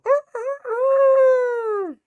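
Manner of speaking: loud, very high imitated rooster crow; high-pitched cock-a-doodle mimicry. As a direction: crow like a rooster, last note long and loud. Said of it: "like, a rooster crow".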